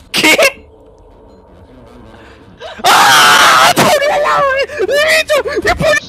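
very high panicked yells; panicked shrieking. Real, not acted. Panicked while monster attacks.

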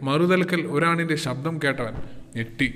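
plain reading voice

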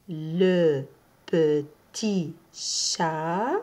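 each word spaced and drawn out; very slow model sentence. Shown as a demonstration. Teacher reads the phrase slowly for learners to copy. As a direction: dictate it word by word. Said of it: "Je répète la phrase".

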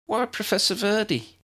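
put-on high, curious girl's voice; high young girl voice. Young girl asks question.

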